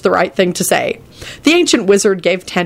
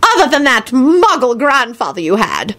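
higher-pitched haughty pronouncement; haughty, disapproving old wizard; scowling old man; 7 semitones higher than usual. Acted. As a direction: declare it with disdain. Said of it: "he scowled".

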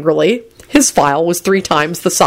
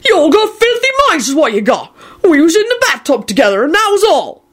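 high, defensive indignation; wrathful yell; 11 semitones higher than usual. A narrator's reading. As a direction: scold them all in outrage. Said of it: "Hagrid yelled wrathfully".

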